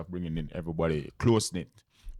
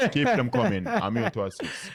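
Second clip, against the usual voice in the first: playful excited; 6 semitones higher, 4 dB louder than usual. Real, not acted. Joking about too many DMs.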